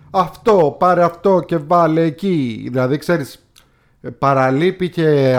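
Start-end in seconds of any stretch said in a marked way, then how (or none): 0.1-2.7 higher, louder mock Italian-accented speech; mock heavy Italian accent. Reviewer imitating the actor's broken English, mock heavy Italian accent. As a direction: imitate a thick Italian accent, slow and raised. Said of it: "τόσο βαριά η προφορά".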